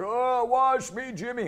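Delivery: high, slow, put-on old man; friend playing grandfather. Friend poses as grandfather in the bath prank, high slow old voice. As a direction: play the helpless grandfather being bathed. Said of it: "he's like".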